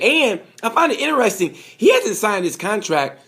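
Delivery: an accidental high squeak of excitement; voice jumps high. Real, not acted. Vlogger musing on Marquez's contract, voice jumps high. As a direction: pitch suddenly shoots up on interesting. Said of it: "my voice, uh, active high".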